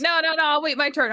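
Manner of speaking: high mocking squeaky voice; mocking a suitor dodging the bow; mock timid suitor. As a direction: whine it like a scared suitor. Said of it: "He was like".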